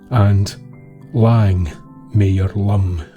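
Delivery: ordinary delivery, nothing marked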